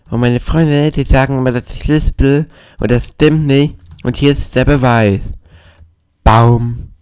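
loud, high put-on lisping voice; staged lisp. Gamer jokingly proving that friends are wrong, staged lisp. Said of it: "ich weiß, dass das gestellt ist".

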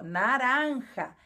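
slow "naranja" with a throaty j; "naranja" with a guttural j; Spanish naranja. Shown as a demonstration. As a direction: stretch "naranja" and scrape the j. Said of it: "com o J de novo, ó".

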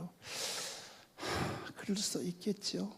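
soft, resigned sigh before the words; sighing sadly. Retold or imitated. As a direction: breathe out a sad, quiet sigh. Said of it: "한숨을 팍 쉬면서 슬픈 표정으로". (한숨 섞인 슬픈 말투)

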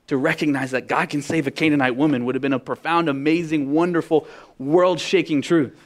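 fiery preacher; full-force preaching. Acted. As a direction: preach it out, building to a climax.

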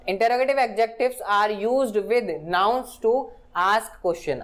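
very slow, word-by-word definition read; slow dictation. Shown as a demonstration. Teacher dictating a grammar definition to students, very slow. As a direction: spell it out slowly for students to copy.

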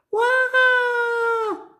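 long, very high sustained wail; acted little-girl wail held on one note; mock toddler scream. A narrator's reading. Susie screaming for her hidden teddy, mock wail. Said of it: "Susie began to scream".

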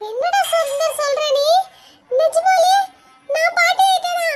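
rising into a loud, high, joyful gasp of disbelief; slow, high and loud, overjoyed; delighted disbelief. Acted. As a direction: grandmother hearing she's a great-grandmother, thrilled shock. Grandmother hears she is great-grandmother.

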